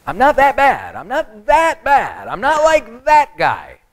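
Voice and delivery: mock a sinner's insistent self-defence; protesting sinner. Sinner pleading to be agreed with.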